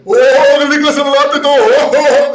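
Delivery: Orlikas's vendor call. Retold or imitated. Imitating the donut seller calling to beachgoers, loud and high. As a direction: call out to the whole beach like a showman selling donuts. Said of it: "And he does this persona".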